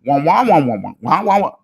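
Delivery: wordless wah-wah babble, swooping up and down; Charlie Brown teacher. Shown as a demonstration. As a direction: honk out loud trombone-like wahs. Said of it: "Charlie Brown teacher voice".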